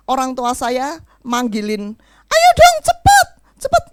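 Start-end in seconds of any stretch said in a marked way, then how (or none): 2.3-3.8 high, loud calling mother; mother hurrying. Retold or imitated. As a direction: call out like an impatient mom. Ibu memanggil anak untuk nikah. (ibu yang memanggil anaknya, tinggi dan keras)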